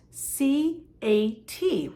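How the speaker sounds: each letter named slowly, C, A, T; spelling out letters. Shown as a demonstration. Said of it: "spell small words like cat".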